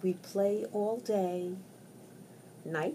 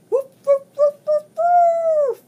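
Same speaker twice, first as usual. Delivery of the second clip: yelping dogs; 12 dB louder than usual. Acted.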